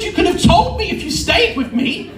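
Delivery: high-pitched acted complaint; indignant protest. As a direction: protest to the one who abandoned you, voice shooting up.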